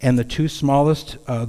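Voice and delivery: usual speaking voice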